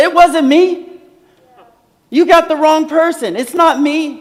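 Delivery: high, loud, indignant denial; pretend protest. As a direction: play the accused crying innocent. Protest Jesus never made.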